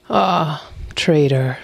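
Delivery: usual speaking voice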